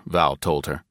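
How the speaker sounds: usual speaking voice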